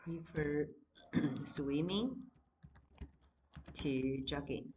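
each word said on its own with long pauses; spaced-out repeated example; example read again. Shown as a demonstration. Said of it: "老师也一样给你句子好了".

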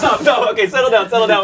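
ordinary delivery

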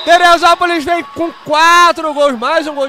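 high, loud, slow goal yell; explosive goal commentary. Real, not acted. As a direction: stretch out the goal call. Second goal, commentator erupts.

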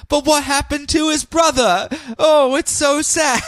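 hammed-up sad lament; mock sadness. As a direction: pout over the poor brother.

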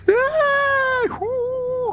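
very high wild asylum whoops; crazy men shrieking. Retold or imitated. Retelling the noise Mina hears outside, shrieked. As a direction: shriek like madmen down the hall. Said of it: "crazy men".

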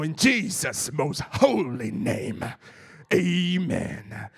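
low, slow mock American cowboy prayer ending; American cowboy accent. Preacher imitating a pastor closing prayer, hammed-up American cowboy accent. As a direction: draw out the holy words in a fake American cowboy accent. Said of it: "he turns into some American cowboy".

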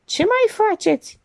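high-pitched amused greeting. A narrator's reading. Stratian lounging in an armchair greets the others, amused. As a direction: pitch it high, with a taunting grin. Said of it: "rânji amuzat".

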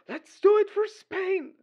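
loud, high, drawn-out patriotic cry; fervent rallying cry. Retold or imitated. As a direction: shout it like a die-hard fan. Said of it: "it's like, seriously. They go like".

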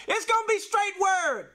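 preaching shout, full force; shouted declaration. Real, not acted. Preacher shouts to the congregation at the climax, full force.

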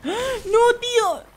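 high panicked cry; scared scream. Real, not acted. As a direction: shriek in fright. Scared by monster.

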